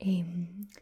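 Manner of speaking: whispered Glasgow Scots, rolled R's; soft Glaswegian ASMR whisper; Glaswegian accent. Shown as a demonstration. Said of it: "So the Glaswegian accent is one that".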